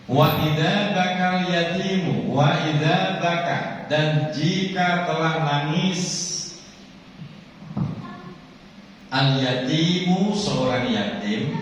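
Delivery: high, slow, drawn-out chanting; slow sermon recitation. Acted. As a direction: make the throne tremble in their ears. Hadith recited then explained slowly. (melantunkan hadis dengan pelan)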